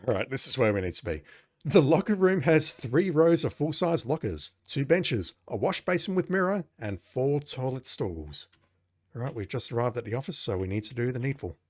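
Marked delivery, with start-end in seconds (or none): 1.6-8.5 raised, projected announcer read; game narration. Acted. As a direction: list it like a tour announcer, slowing on the last item. Reads game text aloud.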